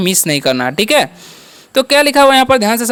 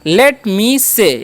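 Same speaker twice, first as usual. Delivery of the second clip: slow pronunciation demo; much slower than usual. Shown as a demonstration. Teacher slowly models the phrase for Hindi students. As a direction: say Let me say very slowly, word by word. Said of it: "तो क्या बोलेंगे?".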